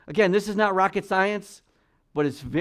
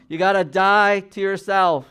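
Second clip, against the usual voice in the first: loud, higher preaching push; full-force preaching; 4 semitones higher, 7 dB louder than usual. Acted. Pastor preaching to the congregation, loud and emphatic. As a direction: drive the point home to the congregation.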